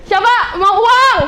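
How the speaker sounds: loud, high, drawn-out call to the crowd; rally the audience with a stretched shout. Real, not acted. Calling out to the audience.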